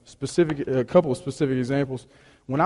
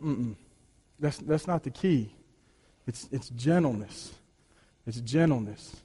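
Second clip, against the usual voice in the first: soft gentle correction; 4 semitones lower, 10 dB quieter than usual. Retold or imitated. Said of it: "Paul says".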